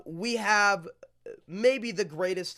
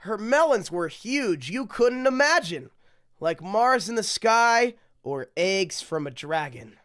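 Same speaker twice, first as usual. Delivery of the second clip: sing-song rhyming read-aloud; storybook reading; 5 semitones higher, 7 dB louder than usual. Acted. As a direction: read it like a bedtime picture book. Reads rhyming picture book aloud.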